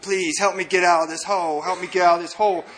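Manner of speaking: ordinary talk, nothing marked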